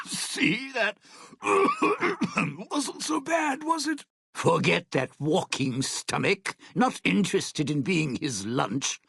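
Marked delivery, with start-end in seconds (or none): 0.1-4.0 high, strained, choked voice; coughing while trying to sound unbothered; talking through coughs. Acted. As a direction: force the words out between coughs. Talking through coughs after fog.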